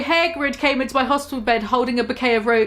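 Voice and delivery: ordinary delivery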